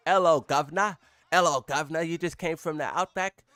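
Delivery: bad mock British greeting drifting Australian; botched UK accent. Streamer greeting a UK viewer in a botched British accent. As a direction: attempt a UK accent and miss. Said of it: "I don't know how to mimic U K".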